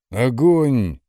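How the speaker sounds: loud barked command; shouted order. A narrator's reading. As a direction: yell it as a battle command. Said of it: "заорал я".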